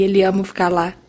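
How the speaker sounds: usual speaking voice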